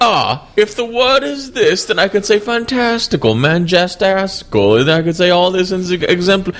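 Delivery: parade the fancy words in an exaggerated impression; Russell Brand. Guest spoiling a word game with big words, mocking impression. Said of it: "Russell Brand would be like".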